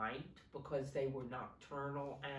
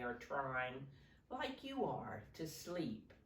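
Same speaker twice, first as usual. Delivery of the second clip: storybook reading; 5 dB louder than usual. Acted. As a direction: paint the night sounds for the kids. Reads picture book to children.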